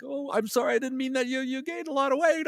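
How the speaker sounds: high, put-on woman's voice; Robin. Co-worker apologising for the fat remark, high mimicked voice. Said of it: "And she's like".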